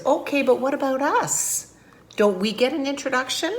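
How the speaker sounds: high-pitched, put-out little fellow; animated picture-book complaint; indignant storybook character. Acted. Spidey complains about being left out.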